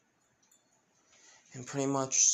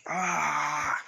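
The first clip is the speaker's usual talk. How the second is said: drawn-out cry of pain; mild acted scream of agony; 3 semitones higher, 4 dB louder than usual. Licht struck by a sudden headache, pained scream. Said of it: "screaming in pain".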